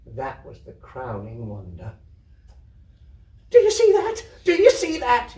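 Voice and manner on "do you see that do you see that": very high, loud excited yell; boy yelling to the little girls in amazement. Acted. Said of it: "yelled Pip".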